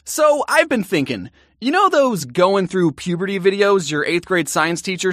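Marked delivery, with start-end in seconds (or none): none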